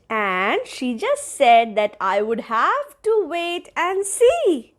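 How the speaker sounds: usual speaking voice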